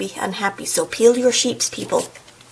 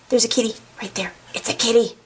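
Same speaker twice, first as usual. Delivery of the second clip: hushed delight; excited whisper; 3 dB quieter, faster than usual. Pretend. Cat lover spots a kitty in the book, excited whisper. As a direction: whisper it as if pointing out something precious.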